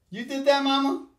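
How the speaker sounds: high sing-song questioning; high playful voice. Real, not acted. Questioning child about mess.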